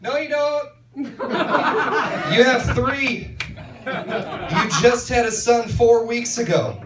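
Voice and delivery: high, slow mock yell of correction; friend yelling. Friend corrects her at the bar: high mock yell. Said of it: "one of her friends yells".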